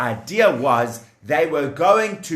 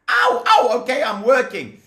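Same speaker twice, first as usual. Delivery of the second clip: yelping in pain; 8 semitones higher than usual. Acted. Slave being smacked.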